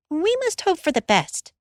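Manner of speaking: lightly voiced mother, high and comforting; a girl copying her mother's calm; a child mimicking a mother's reassurance. A narrator's reading. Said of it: "like Mother".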